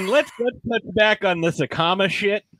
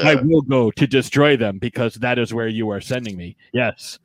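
low, stilted monotone; personality-free henchman; 5 semitones lower than usual. Retold or imitated. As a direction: recite it woodenly, like a minion without personality. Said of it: "Everybody else is just like".

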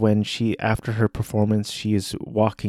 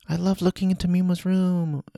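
much higher, sweet but eerie fan voice; creepy fan; 9 semitones higher, 4 dB quieter than usual. Retold or imitated. Stalker fan calling to Mima in the fans' cheerful tone. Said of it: "a little reverb".

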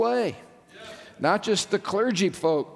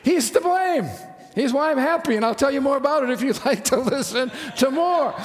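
a lifted, beaming reply with a laugh near the end; excited joyful answer; 6 semitones higher than usual. Retold or imitated. Pastor excitedly telling a stranger why he's happy. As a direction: answer brightly, bursting with joy. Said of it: "And I said".

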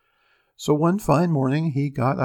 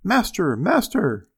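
higher-pitched alarm call; harp calling out; 6 semitones higher, 3 dB louder, slower than usual. A narrator's reading. Stolen harp alerting its master, high call. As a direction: lift the pitch and call for help. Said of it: "the harp called out quite loud".

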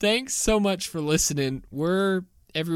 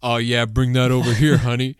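lower, louder celebrity voice, lightly done; Shaq's real voice; 5 semitones lower, 3 dB louder than usual. Retold or imitated. Retelling the hot-mic moment: lower, louder Shaq. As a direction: drop the pitch for a big man answering his wife. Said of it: "you hear Shaq's real voice say".

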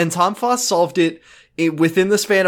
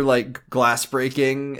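ordinary talk

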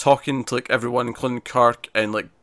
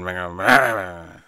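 slow, low cartoon-dog grumble; lightly voiced Muttley mutter; 5 semitones lower, much slower than usual. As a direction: drag the words out like a sulking cartoon dog. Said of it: "a Muttley impression there".

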